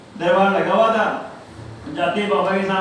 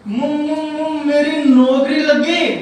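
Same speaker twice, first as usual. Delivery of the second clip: put-on stuttering boy; 5 semitones higher, 5 dB louder, much slower than usual. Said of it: "तुतला के बोलता है".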